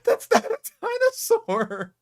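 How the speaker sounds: high, slow indignant yell; outraged shout. Real, not acted. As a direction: cry out in disbelief. Outraged at the video.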